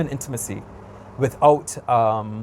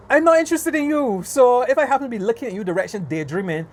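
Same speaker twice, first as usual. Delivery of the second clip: high-pitched, indignant brush-off; pointed, high-pitched rebuff; exasperated retort; 8 semitones higher than usual. Retold or imitated. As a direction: set them straight in a raised pitch. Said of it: "I just be like".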